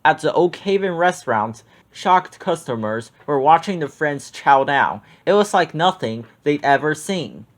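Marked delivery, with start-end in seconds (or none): none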